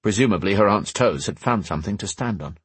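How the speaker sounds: ordinary delivery, nothing marked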